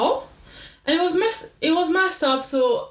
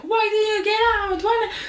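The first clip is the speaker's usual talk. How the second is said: high mock screaming mom; shrill-free high yelling mother imitation; 10 semitones higher than usual. Mom yelling at daughter over the phone, high mock screaming. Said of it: "was screaming at me on the phone".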